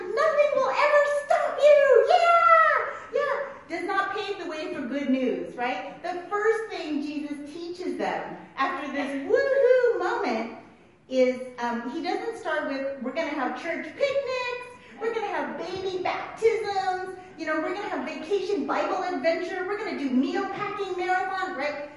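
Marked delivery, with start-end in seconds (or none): 0.0-3.3 loud, high, hammed-up rallying cry; hyped-up cheer. Imitates hyped cheer to the church. 13.0-21.6 higher-pitched, hammed-up list of treats; mock upbeat announcing. Mock upbeat list of church fun.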